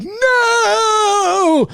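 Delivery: long, high anguished cry; hammed-up heroic scream of protest; Loomis. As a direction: draw out a high dramatic shout. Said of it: "Loomis comes up and is like".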